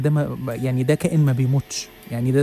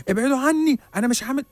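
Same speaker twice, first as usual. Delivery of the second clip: very high, panicked voice; terrified pleading; 11 semitones higher than usual. Retold or imitated. Old man begs unseen pursuers, very high terrified plea. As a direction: plead like something is chasing you. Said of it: "بص لي برعب".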